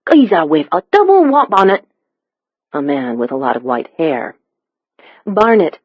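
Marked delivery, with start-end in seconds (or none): 0.1-1.8 higher, thick Cockney; Cockney accent. A narrator's reading. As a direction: pitch it up in broad East End Cockney. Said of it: "The stranger's Cockney accent was nearly impenetrable".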